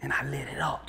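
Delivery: breathy whispered boast. Real, not acted. Preacher recalling his triumph, whispered. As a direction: drop to a whisper for the punch.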